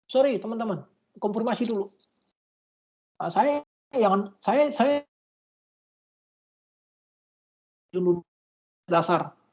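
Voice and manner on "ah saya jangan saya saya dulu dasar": choppy, dropping call audio; glitching audio. Real, not acted. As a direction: voice cutting in and out on a bad connection. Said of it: "Tadi Bapak putus-putus". (suara putus-putus)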